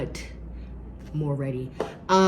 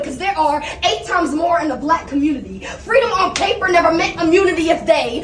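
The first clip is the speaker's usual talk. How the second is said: loud, high, driving slam poetry; slam poetry performance; 12 semitones higher, 8 dB louder than usual. Acted. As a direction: perform it to the whole room. Third round slam performance.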